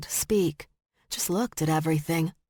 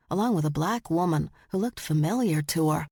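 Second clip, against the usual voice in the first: usual speaking voice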